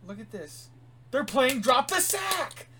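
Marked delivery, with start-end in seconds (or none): 1.1-2.7 loud, high, drawn-out hype yell; excited shouting. Real, not acted. Co-host hyping the plot payoff to a friend, triumphant shouting. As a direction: shout each word slowly like a big announcement.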